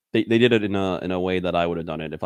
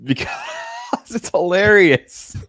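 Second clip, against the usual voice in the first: loud, high burst through laughter; laughing; 10 semitones higher, 6 dB louder, slower than usual. Real, not acted. Explaining the joke name to a friend, laughing hard. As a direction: crack up as you get the words out.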